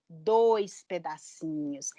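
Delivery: slow, drawn-out answer; slow answer to child. Shown as a demonstration. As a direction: spell out the count slowly for a child. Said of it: "Quantos pedacinhos tem a palavra vaca?". (resposta lenta e arrastada para a criança)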